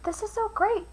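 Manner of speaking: thrilled inner thought; a hint of a cheerful stone; delighted stone. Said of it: "he thought".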